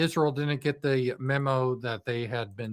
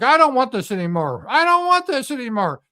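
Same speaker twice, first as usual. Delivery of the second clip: whiny, higher and louder; mocking Trump; 9 semitones higher, 6 dB louder than usual. Trump begging to end the strikes, mock whiny. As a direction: whine it like a beaten kid. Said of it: "Trump is like".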